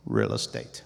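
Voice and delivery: deep, calm voice from above; God answering plainly. Retold or imitated. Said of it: "And then I heard it again".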